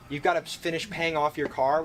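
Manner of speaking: ordinary delivery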